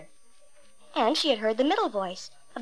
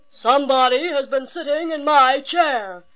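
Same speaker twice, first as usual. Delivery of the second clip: great big voice; 3 semitones higher, 6 dB louder than usual. A narrator's reading. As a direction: boom it out like a huge gruff father bear. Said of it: "in his great big voice".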